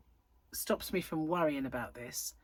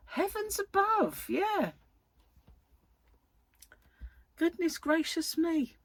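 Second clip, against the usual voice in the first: mock old lady; 11 semitones higher, slower than usual.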